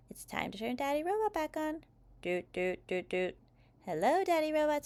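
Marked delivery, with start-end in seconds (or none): 2.2-3.4 short monotone doots; imitated robot start-up beeps. A narrator's reading. Robot turned back on, imitated electronic doots. 3.9-4.8 put-on high little-kid voice; child's greeting. A narrator's reading. Child greets the rebooted robot, high voice.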